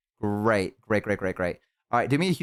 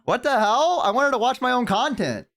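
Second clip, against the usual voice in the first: squeaky-high whining outburst; indignant protest; 12 semitones higher than usual. Real, not acted. Streamer defending watching own content, indignant. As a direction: complain with pitch way up.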